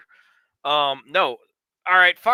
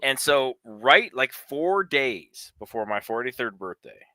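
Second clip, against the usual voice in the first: usual speaking voice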